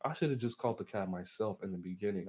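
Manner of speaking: ordinary delivery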